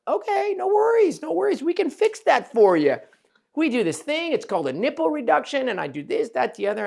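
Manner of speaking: calm her down with a bright, eager tone; reassuring. Retold or imitated. Surgeon retelling how he reassured his patient, high and soothing.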